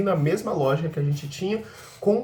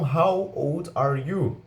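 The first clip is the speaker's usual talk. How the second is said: put-on very slow "How old are you?"; careful teacher demo; slow model question; much slower than usual. Shown as a demonstration. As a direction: lay each word out slowly for learners. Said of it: "Nós temos a expressão".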